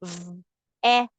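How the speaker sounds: "V-et" split into V and et; segmented spelling. Shown as a demonstration. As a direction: say "V-et" in two parts, V then et. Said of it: "we have our vet".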